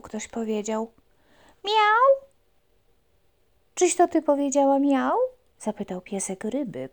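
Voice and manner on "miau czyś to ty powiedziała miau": high, loud puppy question. Acted. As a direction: ask the fish eagerly. Puppy asks fish.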